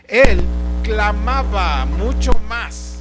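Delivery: "él" loud, then "clamaba mucho más" drawn out slowly; slow, booming emphasis on "él clamaba mucho más"; emphatic proclamation. Real, not acted. Preacher stresses the outcry.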